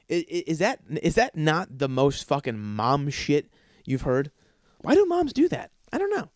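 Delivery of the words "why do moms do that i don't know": much higher whiny mom voice; mocking mom. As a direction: parody a clueless mom. Mocking moms again.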